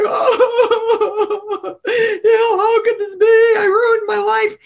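high, loud hammed-up wail; high mock sob, mixed with laughs; mock crying. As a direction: wail about a ruined life, half laughing. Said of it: "I started crying".

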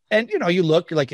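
ordinary talk, nothing marked

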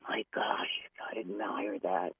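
whispered in reverent awe; soft whispered admiration; awed whisper. Real, not acted. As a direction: hush it like a confession. Whispered awe at clean comedians.

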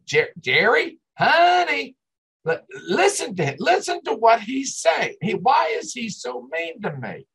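mock-wail to Jerry, honey, like a spoiled wife; whiny wife. Mimicking Patty complaining to Jerry.

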